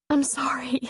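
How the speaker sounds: hushed whisper, fighting tears; barely voiced apology, ashamed. A narrator's reading. She apologises, composing herself.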